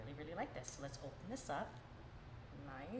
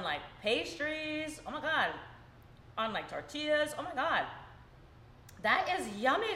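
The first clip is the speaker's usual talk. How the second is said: higher, louder food rave; excited raving; 5 semitones higher, 5 dB louder than usual. Real, not acted. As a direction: gush about it with rising delight. Raving after the taste.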